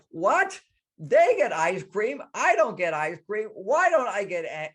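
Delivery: put-on high, loud, indignant child outburst; mock tantrum, loud and high-pitched; angry little girl. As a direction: imitate a furious kid protesting, drawn-out and high. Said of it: "She gets angry".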